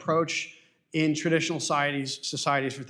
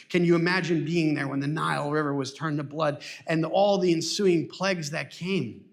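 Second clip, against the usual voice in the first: usual speaking voice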